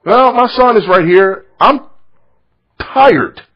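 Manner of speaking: well, my son is right here, fed up and loud; exasperated outburst. Real, not acted. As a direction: let the frustration burst out. Exasperated aside about the heckler.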